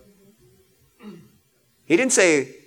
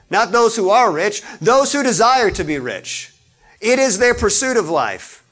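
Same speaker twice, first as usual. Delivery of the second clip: preaching at full force; 4 semitones higher than usual. Acted. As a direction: drive the point home from the pulpit. Preaching the notes loudly.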